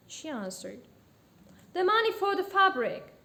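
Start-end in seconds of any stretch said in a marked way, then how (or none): 1.7-3.1 loud, high protest; insistent shopkeeper. A narrator's reading. Shopkeeper demands payment from a customer, insistent. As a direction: insist with the voice raised.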